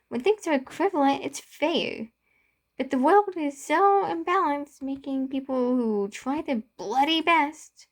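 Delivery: a friend shouting in frustration at the unfair world; angry yelling. Acted. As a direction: yell it at him, voice climbing high. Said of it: "I yelled".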